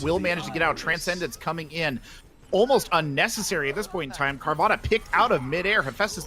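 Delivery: excited shoutcaster in full flow; live play-by-play. Real, not acted. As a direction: call the fight as it happens, excited and punchy.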